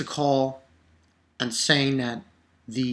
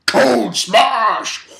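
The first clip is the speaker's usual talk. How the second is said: loud, put-on hero shout; Hulk battle cry; 5 semitones higher, 8 dB louder than usual. Imitating Koon as the Hulk, a loud put-on shout. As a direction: yell it like a comic hero. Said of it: "he's ready to say".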